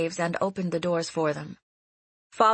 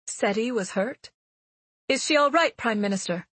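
blurted out; 4 semitones higher than usual. Acted. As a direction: blurt it out in alarm. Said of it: "she blurted out".

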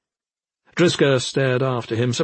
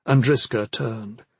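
ordinary delivery, nothing marked

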